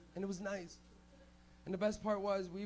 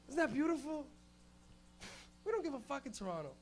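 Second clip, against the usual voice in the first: ordinary delivery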